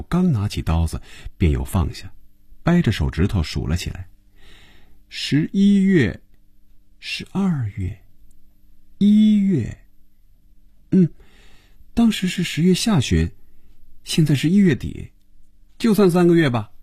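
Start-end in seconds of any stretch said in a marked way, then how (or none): 5.1-16.7 put-on young woman character counting. Phoebe counting months on her fingers for Hongjun, high girlish role voice.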